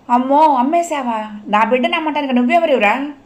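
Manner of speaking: put-on a mother wailing over her sold child; crying out. A narrator's reading. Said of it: "అంది అమ్మ ఏడుస్తూ".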